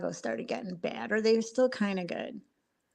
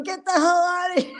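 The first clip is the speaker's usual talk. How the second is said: high, mock heckle from the crowd; retold crowd jeering; 9 semitones higher, 3 dB louder than usual. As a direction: jeer it playfully, pitched up. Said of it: "everybody's booing him".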